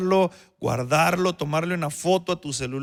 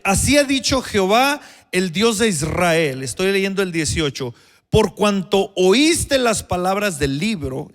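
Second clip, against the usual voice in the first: leer la escritura fuerte y más agudo; lectura bíblica enfática; 3 semitones higher, 5 dB louder than usual. Acted. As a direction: proclama el texto al pueblo. Reads scripture with force. (proclamación solemne de la palabra)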